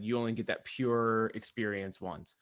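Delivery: ordinary talk, nothing marked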